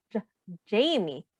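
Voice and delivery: ordinary talk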